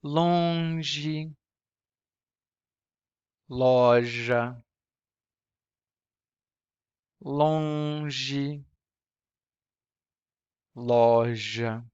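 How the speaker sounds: nasal "longe" against oral "loja"; longe versus loja. Shown as a demonstration. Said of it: "not really counterparts".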